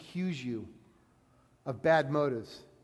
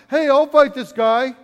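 loud, higher, slow brag; cocky boast; 6 semitones higher, 11 dB louder than usual. Retold or imitated. Young David volunteering to King Saul, a loud, slow brag. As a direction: shout it like a fearless kid.

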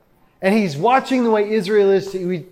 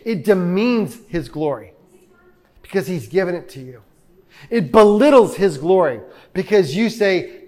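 usual speaking voice

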